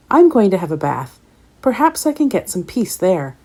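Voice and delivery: ordinary delivery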